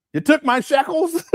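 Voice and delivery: high mock voice breaking into laughter; laughing mock accusation. Mocking her shekels claim to viewers, laughing and high.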